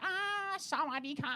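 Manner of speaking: high sing-song Thai greeting; a high Thai vendor voice; ladyboy voice. Retold or imitated. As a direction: greet sweetly like the stall lady. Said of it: "straight for a ladyboy voice".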